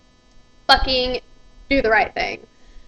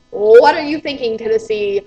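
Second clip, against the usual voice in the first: ordinary delivery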